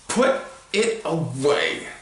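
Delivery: slow threatening snarl from a furious uncle. A narrator's reading. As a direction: snarl it into his ear. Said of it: "Uncle Vernon snarled".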